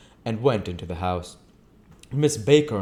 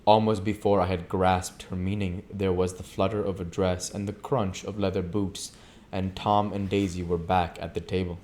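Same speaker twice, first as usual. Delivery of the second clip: plain reading voice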